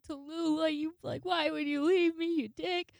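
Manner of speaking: high, loud, tearful whine; hammed-up crying complaint; drunken mock wailing. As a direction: wail like a melodramatic drunk left behind. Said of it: "I'm sitting on the floor drunk".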